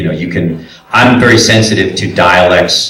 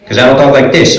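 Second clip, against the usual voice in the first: higher-pitched put-on Brooklyn accent; 6 semitones higher, faster than usual. Shown as a demonstration. Coach showing his hometown talk, Brooklyn accent. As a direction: slip into a Brooklyn neighborhood accent. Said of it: "born and raised in Brooklyn".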